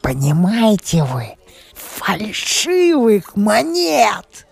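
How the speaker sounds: high, slow, triumphant drawl; gleeful. A narrator's reading. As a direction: savour each word with glee. Brisher gloats over the news.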